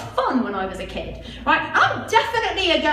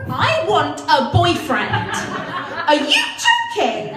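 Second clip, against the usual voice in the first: incredulous; 2 semitones higher, 5 dB louder, much slower than usual. Real, not acted. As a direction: stretch it out in disbelief. Incredulous at book title.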